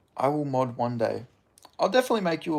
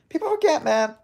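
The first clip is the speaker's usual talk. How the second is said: mock podcast refrain; 4 dB louder than usual. Streamer mocks a podcast refrain, voice pitched high. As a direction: tease the line like a podcast host.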